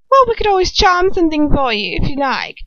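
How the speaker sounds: lightly voiced society woman. A narrator's reading. Unknown woman offers charm.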